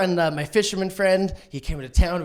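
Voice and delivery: ordinary talk, nothing marked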